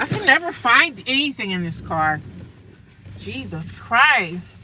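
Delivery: loud, exasperated complaining; frustrated outburst. Real, not acted. Annoyed vlogger searching her messy car, loud exasperation. Can't find shoes in car.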